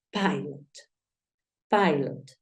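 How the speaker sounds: "pilot" said as "PAI-lot"; English "pilot", first syllable like "pie"; English pronunciation. Shown as a demonstration. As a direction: open the I in "pilot" to "ai". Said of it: "sería la pronunciación de esta palabra".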